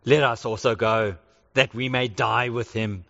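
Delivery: low, grim voice of a doomed disciple; a gloomy pessimist resigned to die; resigned Thomas. Retold or imitated. Thomas telling the disciples, in a low resigned voice. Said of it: "said to the rest of the disciples".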